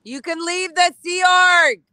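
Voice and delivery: high, loud shout across the street. Real, not acted. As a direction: call out to the people inside, full voice. Shouting at Scientology building.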